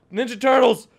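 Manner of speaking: ordinary talk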